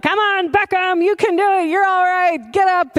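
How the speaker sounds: put-on high, loud cheer to a fallen toddler; encouraging call. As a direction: call out bright encouragement to get him up.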